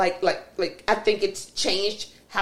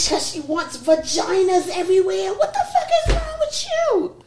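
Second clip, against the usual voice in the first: high-pitched exclamation, pitch leaping at the end; exasperated outburst; 9 semitones higher, 4 dB louder than usual. Acted. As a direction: cry it out in exasperation. Mock exasperated outburst at the man.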